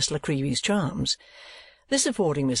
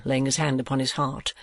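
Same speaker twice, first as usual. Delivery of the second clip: plain reading voice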